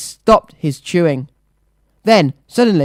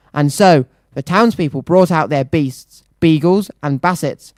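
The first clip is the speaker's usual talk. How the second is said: usual speaking voice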